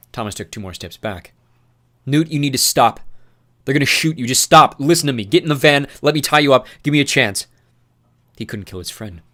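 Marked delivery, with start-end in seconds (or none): 2.1-7.5 louder, higher urgent plea; desperate urging. Acted. Thomas pleading with Newt to stop, desperate and loud. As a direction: beg him fast to stop.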